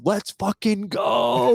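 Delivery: slow, high, drawn-out hype; excited cheer. Retold or imitated. Friend celebrating a score he thinks ties the game, drawn-out high cheer. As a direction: stretch it out like a fan celebrating too early. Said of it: "So excited".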